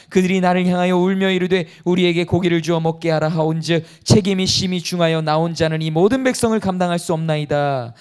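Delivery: loud, solemn Bible reading; scripture reading. Acted. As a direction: proclaim the verse to the congregation. Reads Moses' complaint from Numbers. (큰 소리로 성경 봉독)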